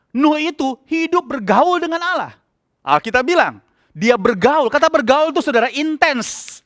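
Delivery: high-pitched fervent preaching. Acted. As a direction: urge the congregation with full conviction. Preaching at full force. (khotbah berapi-api dengan nada tinggi)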